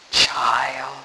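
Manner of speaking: low, soft, solemn voice; solemn great voice. A narrator's reading. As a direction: say it low and soft, with grave authority. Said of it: "said the voice".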